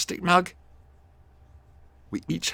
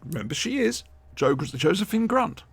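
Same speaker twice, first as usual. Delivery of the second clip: ordinary talk, nothing marked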